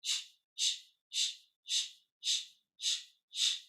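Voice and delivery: short unvoiced shh bursts from the belly; pulsed shh. Shown as a demonstration. Teacher showing a breath exercise, pulsed hissing shh. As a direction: push each shh out with the belly. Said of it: "let's do that again".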